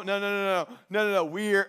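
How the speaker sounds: usual speaking voice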